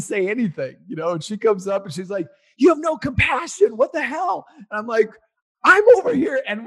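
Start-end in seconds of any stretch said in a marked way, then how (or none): 2.6-4.5 imitating his wife's much higher, louder outburst; angry wife. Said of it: "now my wife is pissed at me". 5.6-6.4 much higher, much louder retold snap back; angry retort. Arguing with wife at sign.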